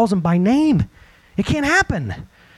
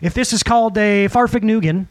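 ordinary talk, nothing marked

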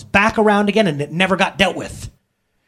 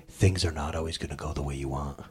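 low, soft, matter-of-fact telling-off; stern lesson to kids; 11 dB quieter than usual. Pretend. Pastor voicing a parent telling kids straight. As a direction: deliver it like a blunt parent. Challenging children, mock flat voice.

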